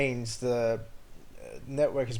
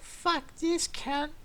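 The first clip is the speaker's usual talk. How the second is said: much higher, slow, put-on voice of an imagined raging old driver; mock road rager; slower than usual. Imagined road rager plotting revenge, mock high voice. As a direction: ham up a spiteful old lady. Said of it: "they were like".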